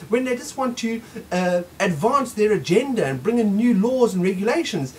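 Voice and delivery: ordinary talk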